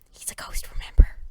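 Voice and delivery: hushed ghostly whisper; spooky whisper. A narrator's reading. Narrating the ghost bandmate, hushed eerie whisper.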